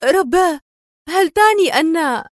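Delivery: high, astonished gasp of words; stunned exclamation. A narrator's reading. Rachel grasping Adham's meaning, stunned and high. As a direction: exclaim in shock, then break off. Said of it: "هتفت مبهورة".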